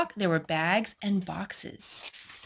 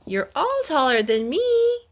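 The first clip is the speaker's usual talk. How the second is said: small mouse; 8 semitones higher, 5 dB louder than usual. A narrator's reading. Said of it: "Mouse says".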